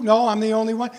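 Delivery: normal talking voice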